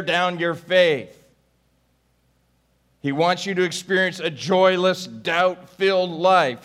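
rising, intense sermon voice; fervent preaching. Acted. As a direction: preach it with mounting urgency. Preaching at full force.

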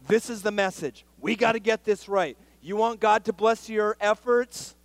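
loud, high-pitched pulpit preaching; fiery preaching. Acted. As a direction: drive the point home to the church. Preacher at full force.

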